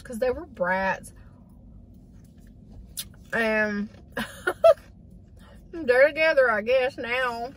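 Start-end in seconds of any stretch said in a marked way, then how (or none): 3.3-7.5 laughing through tears. Real, not acted. Tearful laugh about dead dogs.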